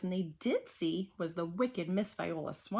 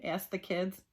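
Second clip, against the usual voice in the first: ordinary delivery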